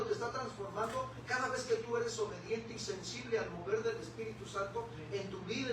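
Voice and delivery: ordinary delivery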